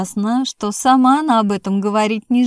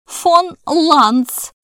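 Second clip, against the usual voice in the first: much higher, louder hammed-up shock; overacted astonishment; 7 semitones higher, 4 dB louder than usual. A narrator's reading. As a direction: overplay the disbelief. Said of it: "повторила Муза". (переигранное изумление, громче)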